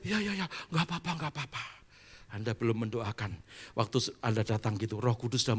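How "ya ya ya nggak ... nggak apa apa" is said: soft, dropping mumbled consent; weary murmur of agreement; resigned quiet. Acted. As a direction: let it trail off, giving in. Resigned relative accepting prayer.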